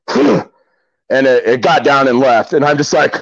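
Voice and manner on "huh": short, high huff like a bear; imitated bear huff. Bear five feet behind me, imitated huff. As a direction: mimic the bear's noise in one puff. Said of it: "the bear kinda goes".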